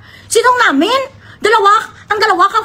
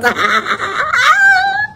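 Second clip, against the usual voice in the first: high shrieking laugh; real helpless laughter; crying laugh; 12 semitones higher than usual. Real, not acted. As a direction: laugh till it cries. Laughing at own joke.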